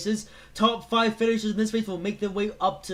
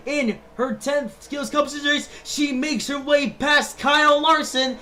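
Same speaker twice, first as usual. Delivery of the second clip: shouting, excited live play-by-play; race commentator; 6 semitones higher, 9 dB louder than usual. Acted. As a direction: call the race at full hype.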